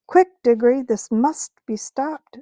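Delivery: quick, loud urgent call to a friend. Acted. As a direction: get his attention fast in the chaos.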